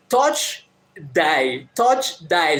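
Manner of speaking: put-on choppy broken Japanese-accented English, word by word; a foreigner barking out "Touch, die" in broken Japanese English. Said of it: "So he says in broken Japanese".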